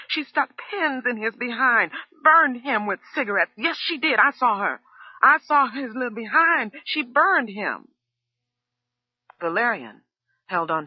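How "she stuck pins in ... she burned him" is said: very high, loud accusing shout. A narrator's reading. Cook telling the husband what she saw, high shouting. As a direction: expose her at full voice. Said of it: "still shouting".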